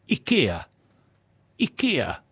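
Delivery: ikea said "ee-KAY-ah" style; mock new ikea. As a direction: imitate the advert's ikea. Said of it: "No, it's ikea".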